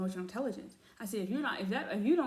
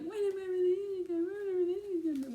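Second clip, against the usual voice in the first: high, whining mock-crying voice; hammed-up high-pitched whine; mock whiny client; 10 semitones higher than usual. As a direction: wail it like an impatient, pleading client. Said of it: "I get a lot of readers and like".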